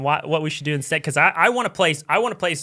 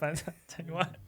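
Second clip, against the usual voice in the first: winding down laughter; 10 dB quieter than usual. Real, not acted. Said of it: "you ever think about doing stand-up".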